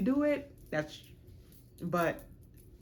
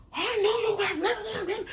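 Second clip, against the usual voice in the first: high, loud, fast mock yell; mock outraged shout; 4 dB louder, faster than usual. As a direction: yell it back like a jealous man. His outburst, retold.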